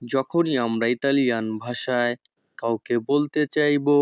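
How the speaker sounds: ordinary talk, nothing marked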